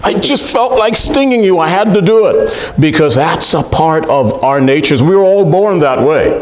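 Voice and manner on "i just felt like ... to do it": shrugging scorpion. Retold or imitated. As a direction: play the scorpion owning its nature. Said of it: "That's what the scorpion said".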